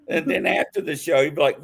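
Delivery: ordinary talk, nothing marked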